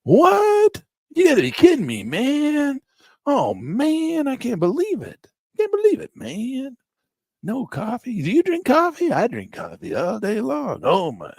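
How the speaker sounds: high, loud disbelief; shocked stoned hippie at no coffee; stoner customer. Retold or imitated. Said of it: "Guys come".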